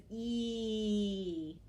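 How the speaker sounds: long-held "E", as in me; isolated sound. Shown as a demonstration. Teacher confirms the last sound, holding "E" long. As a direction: stretch "E" into one long vowel.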